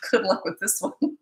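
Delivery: light laugh through the words; laughing quip. Retold or imitated. Coach retells parents handing over a challenging kid, laughing. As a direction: chuckle while imitating a wry send-off.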